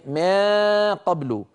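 mock the reciters who open the mouth on ما; imitating the mouth sound. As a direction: imitate the faulty open vowel in place of the nasal. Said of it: "وإنما هذا موضوع".

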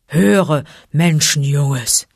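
slow, weighty, rumbling bear; thunderous growl. A narrator's reading. Bear calling the man-cub to listen, thunderous growl. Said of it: "seine Stimme grollte wie Donner".

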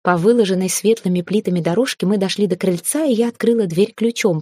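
usual speaking voice

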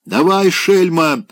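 высоко и громко, протяжно; требует во всю глотку; крик во всё горло. Acted. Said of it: "закричал опять во всё горло". (пьяный Опёнкин орёт на Марину, высоко и громко)

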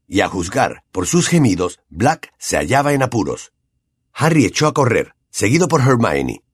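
plain reading voice

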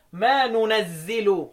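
very slow ننزل as "nunazzilu"; n first and "zi" in ننزل, drawn out; nun and kasra in ننزل. Shown as a demonstration. Teacher lists the third reading, slow nunazzilu for ننزل. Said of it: "يبقى أنا عندي قراءة".

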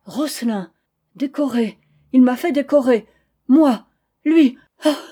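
rising to a loud, broken cry; husband choking on the name of his rival; stammering outburst. Acted. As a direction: build from a stammer to an outcry. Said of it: "bégayait".

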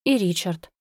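ordinary delivery

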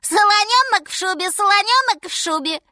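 high, loud monkey squeal; shrieking little monkey. A narrator's reading. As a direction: call out excitedly in a high monkey voice. Monkey shouting gleefully.

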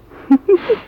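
loud, high burst of laughter. Acted. Hostage laughs at the comic-strip line, loud and high. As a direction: laugh out loud at your own joke. Joke about flying away.

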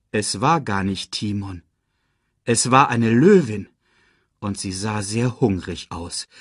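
plain reading voice

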